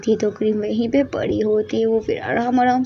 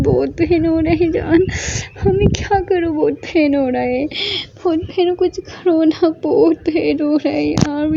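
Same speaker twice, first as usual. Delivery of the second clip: high whimpering plea, voice a touch teary; acted sobbing; 5 semitones higher than usual. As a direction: beg for help through mock tears. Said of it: "फूट फूट के रोने लगी". (हल्का नाटकीय रोते हुए गिड़गिड़ाना)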